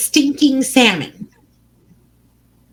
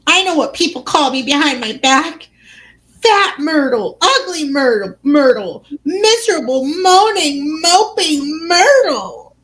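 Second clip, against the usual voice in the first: high, whiny listing of insults; self-pitying mock wail; 6 semitones higher than usual. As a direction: moan each name with hammed-up misery. Myrtle wails her cruel nicknames.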